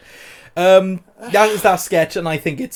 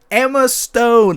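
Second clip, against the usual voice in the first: slow, louder hyped name; excited announcement; 6 semitones higher, 5 dB louder, much slower than usual. Real, not acted. Host excitedly introduces the actress. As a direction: announce her like a star. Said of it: "excited name announcement".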